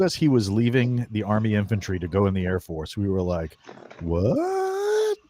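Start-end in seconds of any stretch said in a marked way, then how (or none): 4.0-5.2 high, squeaky disbelieving what; shocked what. Retold or imitated. Retelling friend's news, squeaks out shocked disbelief. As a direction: mimic the crew's stunned disbelief. Friends hear he's leaving infantry.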